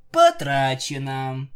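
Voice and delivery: slow, loud announcer call; loud, drawn-out proclamation; game-over announcement. Acted. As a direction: stretch it out like a game-over verdict. Mock game-over announcement in story.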